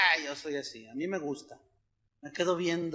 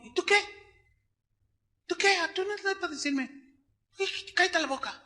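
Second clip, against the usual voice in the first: high, defiant mock comeback; arrogant retort; 10 semitones higher, 4 dB quieter, faster than usual. Preacher imitates a proud sinner snapping back at his critic.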